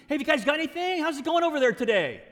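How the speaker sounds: loud, high, quick call of casual interest; friendly curiosity. Shown as a demonstration. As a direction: ask brightly across the water, curious. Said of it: "it's kinda like a curiosity".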